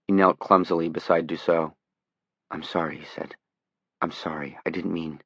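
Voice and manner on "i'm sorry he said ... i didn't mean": quiet. Acted. Johnny apologises, shaken.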